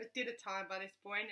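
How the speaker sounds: ordinary delivery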